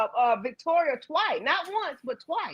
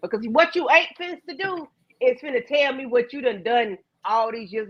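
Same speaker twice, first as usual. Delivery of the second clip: normal talking voice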